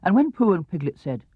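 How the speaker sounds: usual speaking voice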